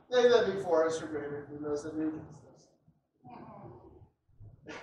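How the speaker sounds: low, soft, assured prophet voice; calm prophet. Acted. Elisha calmly reassuring his frightened servant, low and soft. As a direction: settle the servant's fear gently.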